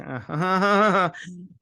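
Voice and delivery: high laugh at own mean joke; wicked little burst of laughter; gleeful laugh. Real, not acted. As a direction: laugh gleefully at your dark quip. Laughs at wishing character dead.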